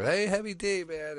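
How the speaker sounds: high-pitched imitation of a fan calling out; retold fan hail, leaping up high then dropping off on the last word; excited fan call. Crowd outside the club spotting a rapper, excited mock call.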